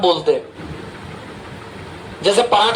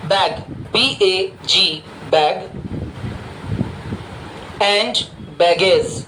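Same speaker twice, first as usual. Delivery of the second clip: word by word, long pauses to let them write; slow dictation; 3 semitones lower, much slower than usual. Shown as a demonstration. Teacher dictates bag and baggage to students, slow and spaced out. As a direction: spell it out very slowly, letter by letter.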